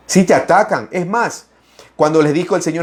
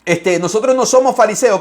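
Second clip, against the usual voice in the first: protesting Pharisee; 6 semitones higher than usual. Retold or imitated. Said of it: "Y dice".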